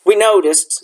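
ordinary delivery

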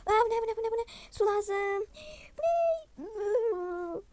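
loud high panicky babble; panicked cries. Pretend. Doll panics loudly after the hot drink, asking for water. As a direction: blurt it frantically, begging for water.

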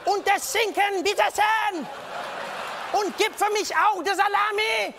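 high, loud, slow oratory; overconfident German. Acted. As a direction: order food like shouting at a rally. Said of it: "Confidence, [name]".

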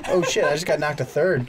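ordinary talk, nothing marked

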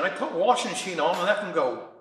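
usual speaking voice

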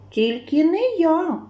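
високий протяжний мишачий голосок; пропищи відмову повільно; писклява відмова. A narrator's reading. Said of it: "пищить верть". (мишеня пищить, відмовляючись)

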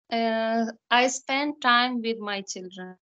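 usual speaking voice

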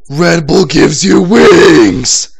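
slow, loud announcer slogan; slow, loud hammed-up ad read; mock ad voice. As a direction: announce it like a commercial. Slogan in put-on voice.

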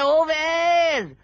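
drawn-out call. Acted. Old man calls Owais back.

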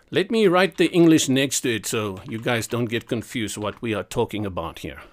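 usual speaking voice

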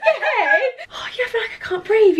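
high, slow giggling question; nervous laughing. Real, not acted. Nervous giggling before eating challenge.

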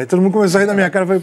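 usual speaking voice

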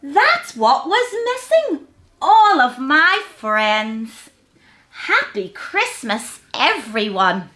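high squeak easing into a drawn-out finish; excited storybook animal voice; squeaking hedgehog. A narrator's reading. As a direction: exclaim it like a delighted little creature. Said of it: "squeaked little Hedgehog".

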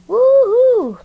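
very high, loud woo-hoo; piercingly high song whoop; sung whoop. Pretend. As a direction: let out a high held whoop. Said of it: "singing a song".

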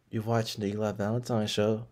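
soft, sultry show intro; seductive voice. Shown as a demonstration. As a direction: breathe the line into the mic. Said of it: "there's a nice seduct".

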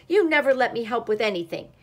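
fast, loud, hurt complaint; wailed. A narrator's reading. Duck blaming friends before leaving, wailed. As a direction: wail the grievance out in a rush. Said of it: "wailed the duck".

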